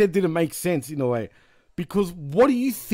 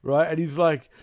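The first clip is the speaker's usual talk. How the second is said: ordinary delivery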